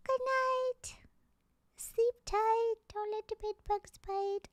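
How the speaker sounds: put-on tiny, very high squeaky baby talk; little baby voice. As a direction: say a bedtime goodbye as a squeaky baby. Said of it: "I'm talking like a little baby".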